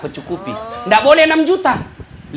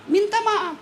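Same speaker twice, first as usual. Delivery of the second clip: minta maaf said much higher and softer; a coaxing, raised "minta maaf"; higher pleading; 10 semitones higher, 5 dB quieter than usual. Shown as a demonstration. Repeats apology two ways.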